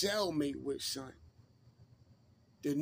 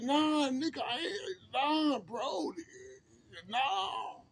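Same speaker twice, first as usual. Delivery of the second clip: panicked denial; 10 semitones higher, slower than usual. Retold or imitated.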